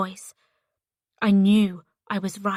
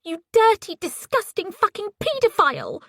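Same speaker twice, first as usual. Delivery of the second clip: high, loud scream of disgust; 11 semitones higher, 4 dB louder than usual. Acted. Ellie screams into the phone at Paisley, high and loud. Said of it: "screamed into it".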